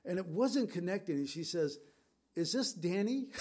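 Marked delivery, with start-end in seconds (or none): 2.3-3.3 lightly imitated little voice, pitched higher; a hint of a small girl on the phone. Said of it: "I could hear this little voice, almost sounded like a little girl".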